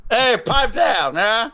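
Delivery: put-on high, slow old-movie wiseguy; old-timey movie voice. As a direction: ham up a 1940s tough guy. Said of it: "the old-timey movie voice".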